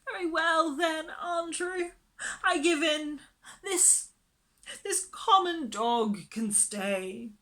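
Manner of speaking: high tearful giving in; tearful surrender. A narrator's reading. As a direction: concede through tears. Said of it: "She sobbed a moment".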